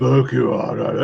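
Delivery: lightly voiced macho Japanese; a hint of a manly growl; virile Japanese man. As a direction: puff it up like a samurai film hero. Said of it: "virile man I'd ever heard".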